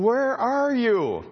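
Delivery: God calling out. Acted. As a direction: draw it out gently like seeking the lost. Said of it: "God says".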